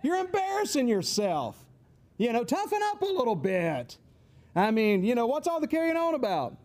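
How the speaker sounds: mock parental irritation, rising in pitch; impatient parent. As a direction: tell off a whining kid. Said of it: "Their reaction is probably".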